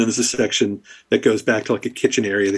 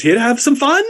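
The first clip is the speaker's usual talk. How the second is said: sly satyr; 11 semitones higher than usual. Acted. Satyr on the bar winking at Sophia, high teasing invitation. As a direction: tempt her with a wink. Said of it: "and he says".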